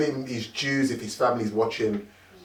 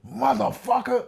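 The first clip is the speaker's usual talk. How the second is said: high, slow angry swear; drawn-out mock-angry swear, much higher; mock outburst; 10 semitones higher, slower than usual. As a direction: snap from calm into rage. [name] turning on him in sketch.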